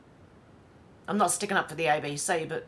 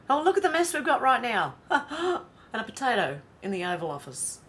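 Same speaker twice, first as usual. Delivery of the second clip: laughing scorn; 5 semitones higher, 4 dB louder than usual. Real, not acted. Laughing at the state of politics.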